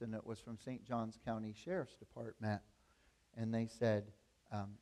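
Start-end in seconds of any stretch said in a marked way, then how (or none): none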